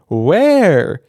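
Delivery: high, loud mock question; loudly. Bunny playing along loudly for the cat, hammed-up loud. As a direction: call it out for show. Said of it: "said Bunny loudly".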